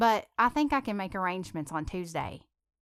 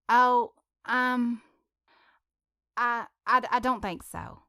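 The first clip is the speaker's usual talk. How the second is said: flustered, halting stammer; 4 semitones higher, 6 dB louder, slower than usual. A narrator's reading. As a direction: stumble over the words nervously. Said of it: "Paige stammered".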